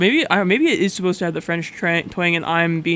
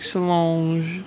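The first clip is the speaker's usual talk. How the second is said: imitating a French way of saying Solange; French pronunciation; 3 dB quieter than usual. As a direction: Solange said the French way, nasal final vowel. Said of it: "You said it with some French".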